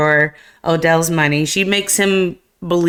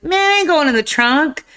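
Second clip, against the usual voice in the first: pitched-up protesting voice, imitating Chris Tucker's character; whiny refusal; 10 semitones higher than usual. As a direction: whine that you won't get in. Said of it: "He's like".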